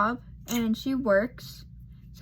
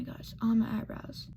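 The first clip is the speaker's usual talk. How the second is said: whispered aside; 5 dB quieter than usual. Real, not acted. Makeup aside while filming.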